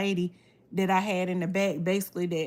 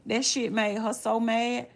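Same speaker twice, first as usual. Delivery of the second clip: ordinary delivery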